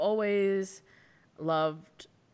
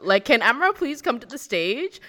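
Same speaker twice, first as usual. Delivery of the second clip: higher, louder retold puzzlement; mock bafflement; 5 semitones higher, 7 dB louder than usual. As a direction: play clueless, calling out for the unknown person. Retold bafflement at the unknown name.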